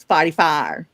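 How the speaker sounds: broad Saint Louis accent demo. Shown as a demonstration. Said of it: "like, one accent. It's".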